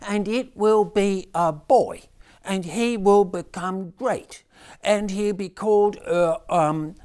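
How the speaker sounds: high, slow, loud recital; boy reciting lines. A narrator's reading. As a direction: stumble through memorised lines.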